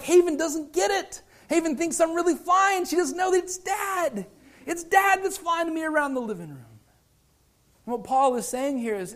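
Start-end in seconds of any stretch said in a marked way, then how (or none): 0.0-6.8 put-on high-pitched little kid voice; imitated three-year-old. Three-year-old son puzzled at his sister, imitated high voice. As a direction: explain it as a small child would. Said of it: "his three-year-old mind, he's like".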